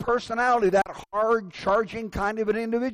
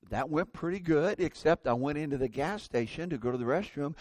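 ordinary talk

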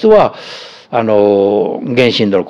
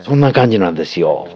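ordinary delivery

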